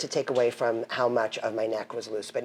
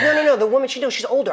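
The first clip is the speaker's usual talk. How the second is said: higher, louder insistent neighbor voice; mock insistent neighbor; 10 semitones higher, 5 dB louder, faster than usual. Neighbor insisting the dog walker is older, mock voice. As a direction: lightly imitate the neighbor correcting her. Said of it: "She goes".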